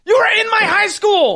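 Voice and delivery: high, loud protest; loud, incredulous reminder; exasperated shout. Real, not acted. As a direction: shout it in indignant disbelief. Protesting that friend should already know.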